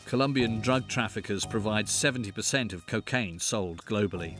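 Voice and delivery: nature documentary. A narrator's reading. Documentary voice-over narration.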